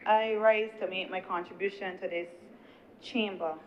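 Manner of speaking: normal talking voice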